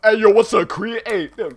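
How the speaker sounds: loud, high swaggering imitation; mock gangster greeting. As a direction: call out like a wannabe gangster. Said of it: "when he's, like, talking to somebody".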